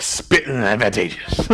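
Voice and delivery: low, spluttering parody of preaching; mock preacher. Pastor joking about how he could preach, mock preacher. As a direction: ham up a spitting preacher, dropping low. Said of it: "Who wants me to preach like that?".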